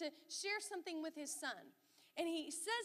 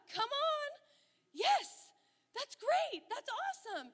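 much higher, louder thrilled cheering; delighted cheer; 12 semitones higher, 8 dB louder, slower than usual. Retold or imitated. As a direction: celebrate it like a proud mom. Mom's delighted inner reaction.

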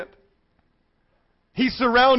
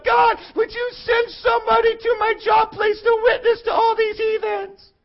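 high-pitched imitation of a whiny plea; mock pleading prayer; 10 semitones higher than usual. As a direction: wail it to heaven in mock desperation. Mimics believers' whining prayer.

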